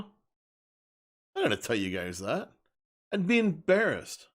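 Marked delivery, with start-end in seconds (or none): none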